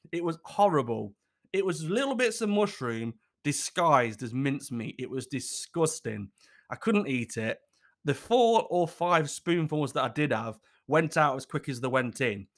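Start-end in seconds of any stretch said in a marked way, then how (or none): none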